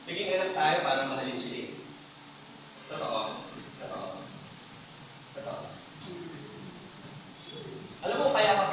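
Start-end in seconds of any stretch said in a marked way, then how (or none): none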